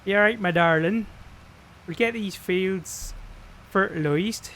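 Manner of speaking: broad West Country yokel; West Country accent. Pretend. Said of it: "do the whole stream in a West Country accent".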